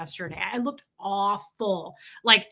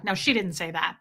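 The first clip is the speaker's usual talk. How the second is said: ordinary talk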